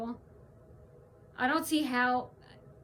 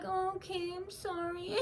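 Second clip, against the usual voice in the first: high, very soft mock apology; lightly mocking meek callers; 7 semitones higher, 8 dB quieter than usual. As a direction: play it small and sorry. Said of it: "they're like".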